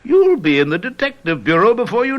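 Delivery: brilliant lifted high; gushing praise. Acted. Detective flatters Pierre in front of his fiancée, gushing. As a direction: lay the praise on thick.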